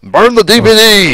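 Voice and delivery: loud, high joking cry; mock outraged shout. Joking with his co-host about the bad dub, loud mock shout. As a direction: demand the disc be burned, hammed-up.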